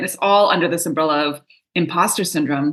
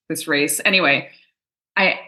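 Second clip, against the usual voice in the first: usual speaking voice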